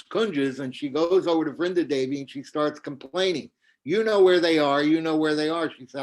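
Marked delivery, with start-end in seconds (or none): 3.8-5.7 accusing. Acted. Kautilya accuses Vrinda Devi.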